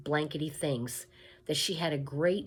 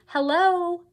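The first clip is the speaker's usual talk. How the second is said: high, much louder cheerful call; merry princess call; 10 dB louder than usual. A narrator's reading. Princess calling to Mig from her horse, high and merry. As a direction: sing out a bright hello across the field. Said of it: "called out merrily".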